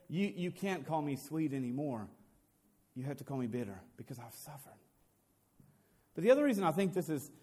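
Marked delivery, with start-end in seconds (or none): 0.1-4.8 softer, sinking into bitterness; bitter. Acted. Naomi telling the town she has suffered, softly bitter. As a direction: let her grief pull the voice down. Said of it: "but she says".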